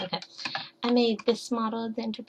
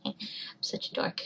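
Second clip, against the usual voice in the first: whispering; 8 dB quieter than usual. Retold or imitated. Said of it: "And I'm like".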